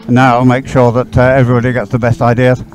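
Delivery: ordinary talk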